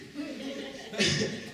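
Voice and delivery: high, loud burst of laughter; real high-pitched laugh. Real, not acted. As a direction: crack up at your own story. Laughing at own story.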